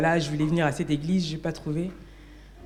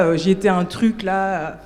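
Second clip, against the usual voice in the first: ordinary talk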